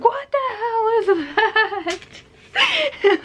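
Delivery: very high, drawn-out freaked-out squeal; comically panicked high wail; mock terror. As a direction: act out a horrified fright. Frightened reaction retold to friend.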